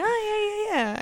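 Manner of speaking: fake agreement. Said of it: "But I'll be like".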